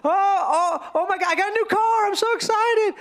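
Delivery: high, hammed-up thrill, quick; excited. New car owner sharing the news, excited. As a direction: gush like it's the best news ever.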